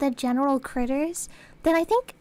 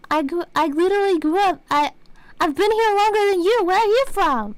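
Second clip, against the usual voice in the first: louder, higher acted outburst; indignant retort; 5 semitones higher, 5 dB louder than usual. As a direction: throw the question back in mock outrage. Retold exasperated retort to doctor.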